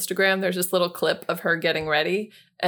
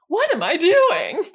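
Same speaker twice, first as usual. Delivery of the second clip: hammed-up thirties movie star, high and slow; mock movie-star exclamation, pitched up; Tracy Ellis Ross; 11 semitones higher, 6 dB louder than usual. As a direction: draw it out loudly like an old-time film diva. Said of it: "did the same thing".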